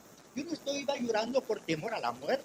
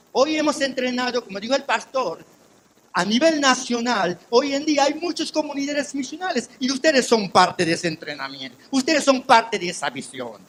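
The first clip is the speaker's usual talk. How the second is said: loud, rising preacher's testimony; full-force preaching; 4 semitones higher, 8 dB louder than usual. Acted. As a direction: preach it out to the church.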